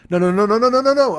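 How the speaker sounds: rapid-fire loud yell, climbing high; battle cry. Retold or imitated. Rider reliving the camel ordeal, loud battle cry. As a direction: yell it in a rising panic-like volley. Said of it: "been my fucking battle cry".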